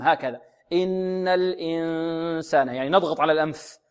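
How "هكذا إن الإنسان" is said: "إن الإنسان" slow, with the n hidden and sounding through the nose; nasal ikhfa demo. Shown as a demonstration. Teacher showing students the correct nasal n, slowly. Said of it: "والربع يخرج من الفم".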